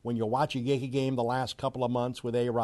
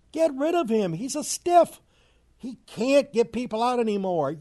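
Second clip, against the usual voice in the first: angry rant; 9 semitones higher than usual. Real, not acted. Ranting about the pitcher.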